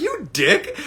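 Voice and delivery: high, laughing mock insult. Retelling calling a friend a dick, laughing high. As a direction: tease him through a laugh. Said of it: "And I was like".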